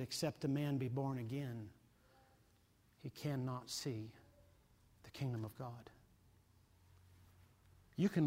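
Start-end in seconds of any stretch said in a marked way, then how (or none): 0.0-5.9 Jesus speaking, hushed. Retold or imitated. Jesus tells Nicodemus face to face, hushed and solemn. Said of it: "Jesus looks him dead in the eyes and said".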